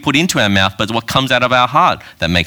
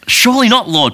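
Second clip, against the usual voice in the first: shocked protest; 12 semitones higher, 4 dB louder than usual. Acted. Peter protests in the reading.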